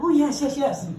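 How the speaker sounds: high, loud, gushing yeses; Christy eagerly accepting the offer to meet the governor. Retold or imitated. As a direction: jump at the invitation, thrilled. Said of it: "And Christy's like".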